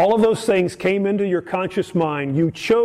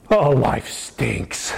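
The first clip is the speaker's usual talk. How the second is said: a slow, lower, mopey "life stinks"; mock gloomy sigh; 6 semitones lower, much slower than usual. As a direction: drag it out like a sulky self-pity moan. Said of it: "let's say your thoughts this week have been".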